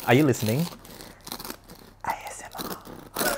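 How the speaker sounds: breathy whispered ASMR. Pretend. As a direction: whisper it softly into the mic. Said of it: "can you hear that? Are you listening?".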